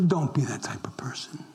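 hushed, breathy warning to the flock; whisper. Real, not acted. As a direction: lean in and whisper it. Pastor warns congregation.

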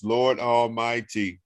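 normal talking voice

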